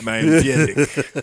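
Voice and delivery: echoing a mock French introduction; bad French accent, amused; put-on Frenchman; horrible French accent. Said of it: "our horrible accents".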